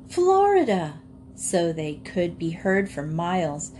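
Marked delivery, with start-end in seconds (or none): 0.1-1.0 high ringing cheer; shouting together. A narrator's reading. Animals cheering together on reaching Florida, loud. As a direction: shout so you're heard for miles. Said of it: "all the animals shouted together".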